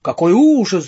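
loud wail of horror; причитание. A narrator's reading. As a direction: lament like an appalled professor. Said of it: "запричитал профессор". (горестный, испуганный возглас)

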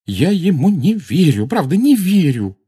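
high, loud, overwrought voice; agitated disbelief. A narrator's reading. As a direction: insist in disbelief, losing control of the voice. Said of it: "Голос ещё плохо повиновался ей". (взволнованно, не владея голосом)

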